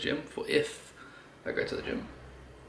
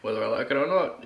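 ordinary delivery

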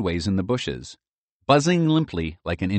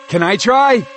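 eager; 13 semitones higher, 10 dB louder than usual. A narrator's reading. Merle eager.

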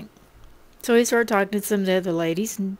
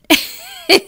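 breathy laugh; 10 semitones higher, 5 dB louder than usual. Real, not acted. Laughs at her own joke.

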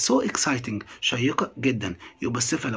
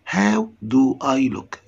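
slow title read; much slower than usual. Shown as a demonstration. Teacher reads English title slowly.

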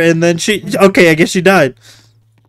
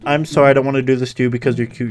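fast, lower, softer throwaway imitation of the cop; mock cop; 8 semitones lower, 9 dB quieter, much faster than usual. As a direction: rattle the cop's line off quickly and quietly. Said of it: "He literally went from".

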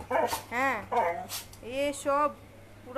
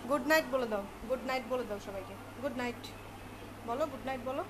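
soft coaxing; 4 semitones lower, 7 dB quieter than usual. Real, not acted. Coaxing child to say good night.

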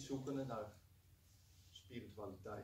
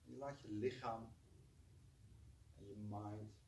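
guided meditation. Acted. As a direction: gently guide them to relax. Leads a short meditation.

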